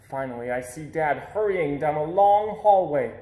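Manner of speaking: ordinary delivery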